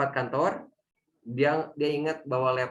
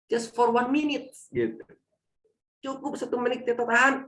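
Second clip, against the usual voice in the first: earnest plea; 12 semitones higher than usual. Real, not acted. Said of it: "urges holding back anger".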